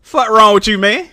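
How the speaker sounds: ordinary talk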